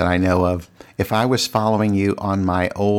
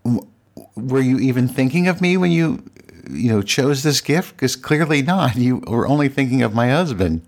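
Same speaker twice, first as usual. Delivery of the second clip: higher-pitched, mildly angry retold thought; indignant inner rant; 5 semitones higher than usual. Podcaster replaying her resentment at a gift for her husband, mildly indignant. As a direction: voice your offended thoughts, pitched up. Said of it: "I got really angry".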